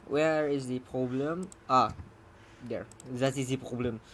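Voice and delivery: put-on English said with a playful French accent; mock French accent. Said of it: "the more French I get".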